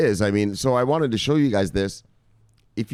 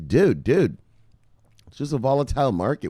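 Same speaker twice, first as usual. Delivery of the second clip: ordinary talk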